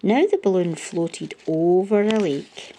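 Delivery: ordinary delivery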